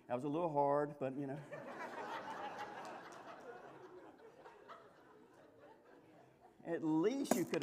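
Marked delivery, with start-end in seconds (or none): 1.4-4.8 high breathy giggle, barely voiced; airy giggle. Real, not acted. Preacher giggling over the couple's fight. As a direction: giggle quietly to yourself. Speaker laughs at story.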